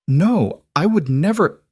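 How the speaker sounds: high, pleading denial in panic; panicked denial. A narrator's reading. Accused woman denies in panic.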